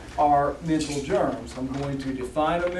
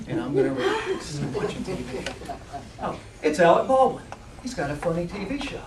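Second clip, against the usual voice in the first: much higher, fast, running TV commentary; excited channel surfing; 9 semitones higher than usual. Pretend. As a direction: flip channels with rising alarm. Pretends to channel surf.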